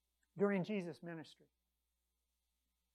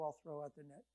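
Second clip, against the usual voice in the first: softer, resigned, rising on I'll; reluctant shrug; 5 semitones lower, 6 dB quieter than usual. Retold or imitated. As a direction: humour him, you doubt it'll work. Peter grudgingly obeys.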